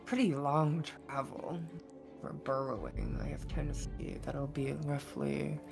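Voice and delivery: usual speaking voice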